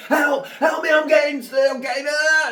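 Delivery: high, loud mock distress yell; fake cry for help. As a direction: scream as if being kidnapped. Faked kidnapping cry for help.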